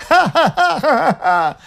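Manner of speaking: slow mocking belly laugh; loud guffaw. A narrator's reading. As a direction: burst out laughing at the beggar. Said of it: "как расхохочется".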